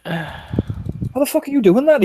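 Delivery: high, startled outburst; shocked at the fast healing; surprised. Acted. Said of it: "He kinda looks at you healing".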